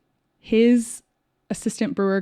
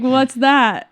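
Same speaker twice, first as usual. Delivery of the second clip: higher, louder puzzled question; mock bafflement; 5 semitones higher, 9 dB louder than usual. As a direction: blurt it out, comically clueless. Baffled reply in retold chat.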